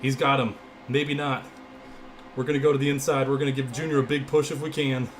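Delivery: loud race commentary; urgent play-by-play, voice pushed up; live play-by-play. Real, not acted.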